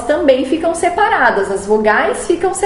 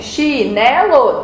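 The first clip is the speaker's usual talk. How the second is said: "Chinelo" slowly split into chi-ne-lo; syllable by syllable; much slower than usual. Shown as a demonstration. Teacher counting a word's syllables for pupils, syllable by syllable. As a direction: count out "Chinelo" in three separate, stretched syllables. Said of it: "você vai contar quantas sílabas tem".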